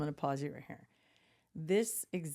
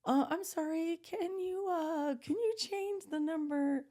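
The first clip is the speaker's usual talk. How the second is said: much higher, slow, hesitant imitation; nervous husband; 11 semitones higher, slower than usual. Mimicking the husband asking the drive-through to change the order, nervous and high. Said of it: "He gets so nervous".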